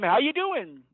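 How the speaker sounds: retold friendly yell. Teacher calling out to approaching police, retold yell. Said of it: "I yelled to them".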